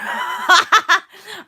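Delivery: loud, high squeal of laughter; high laughter burst. Real, not acted. Vlogger laughing at her own swooning, high and loud.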